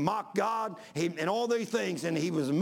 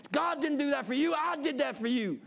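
higher, jeering, right in her face; taunting atheist; 7 semitones higher than usual. Retold or imitated.

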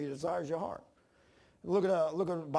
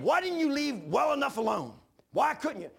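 much higher, louder exasperated yell; frustrated shout at himself after the crash; 11 semitones higher, 8 dB louder than usual. Retold or imitated. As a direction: shout at your own foolishness. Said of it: "I said".

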